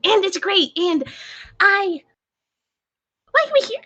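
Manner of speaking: high-pitched, exasperated outpouring; frantic ranting. Acted. Panicking character confessing to Pierre that someone knows, high frantic rant. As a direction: spiral upward from forced cheer into desperate questioning.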